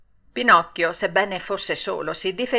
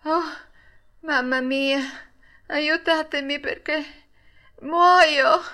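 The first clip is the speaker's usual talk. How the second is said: put-on higher, fading cry of a boy struck down; faint, pleading last words rising at the end; dying boy; 7 semitones higher, slower than usual. A narrator's reading. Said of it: "diventò bianco come un panno lavato". (ragazzo colpito che invoca la mamma, voce alta e flebile)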